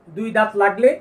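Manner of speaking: ordinary delivery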